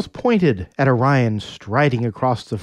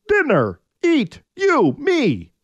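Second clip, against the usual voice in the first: caveman Orion; 13 semitones higher, slower than usual. Acted.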